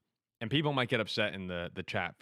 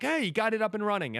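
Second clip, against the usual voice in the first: surprised greeting; 9 semitones higher, 3 dB louder than usual. Retold or imitated. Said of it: "I'm like".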